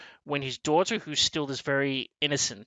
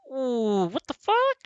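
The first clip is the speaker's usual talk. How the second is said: loud, rising shocked what the fuck; shocked outburst; 10 semitones higher, slower than usual. Retold or imitated. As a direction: blurt it out in the cinema, high and loud. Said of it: "I said that kinda loudly".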